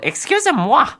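high, loud, hammed-up diva pig voice; terrible Miss Piggy. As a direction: badly mimic a squealing pig diva. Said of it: "my terrible impression of Miss Piggy".

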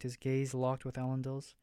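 usual speaking voice

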